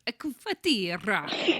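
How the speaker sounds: slow A cafeteria in a mock Italian accent; drawn-out Italian-style A cafeteria. Shown as a demonstration. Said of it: "un cafeteria".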